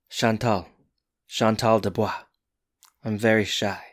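lightly voiced shy French girl, soft; a hint of a coy French girl, quietly. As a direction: softly imitate a demure French mademoiselle. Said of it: "he does, like, a French accent".

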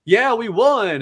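mock victory cheer. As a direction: crow about the win like a bratty champion. Generation of Miracles cheering victory.

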